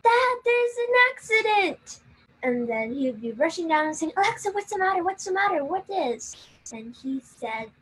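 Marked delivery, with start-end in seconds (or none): 0.0-2.0 high, loud fake alarm; scream. Girl screaming to her dad about an accident, high and loud. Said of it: "I would scream". 4.2-6.3 high, quick worried questions; worried dad. Retold or imitated. Dad running down asking his daughter what's wrong, worried.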